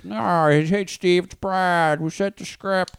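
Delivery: high, put-on impression of an old manager; Brad Mendelsohn. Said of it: "that sounds just like him".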